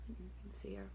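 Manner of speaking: plain reading voice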